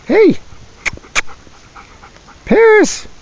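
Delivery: high call across the room. Real, not acted. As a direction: shout for Paris to come. Calling out to Paris.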